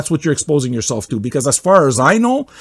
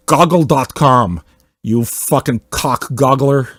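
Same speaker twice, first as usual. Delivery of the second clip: ordinary delivery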